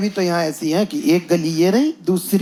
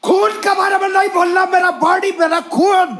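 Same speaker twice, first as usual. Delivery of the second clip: much higher shouting in broken Hindi; mock auto driver shout; 10 semitones higher than usual. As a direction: yell back like the offended driver. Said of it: "वो क्या मुझसे बोलता है".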